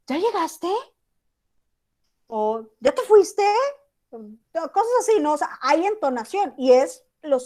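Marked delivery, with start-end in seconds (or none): 0.1-0.7 "¿Ya llegaste?" with a raised questioning pitch; question tune. Shown as a demonstration. Teacher shows students how a question sounds, rising. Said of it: "cuando vas a preguntar algo es". 2.8-3.4 "¿Ya te fuiste?" high and louder, clearly asking; question tune. Shown as a demonstration. Teacher demos question intonation to the class, rising. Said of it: "cuando vas a preguntar algo es".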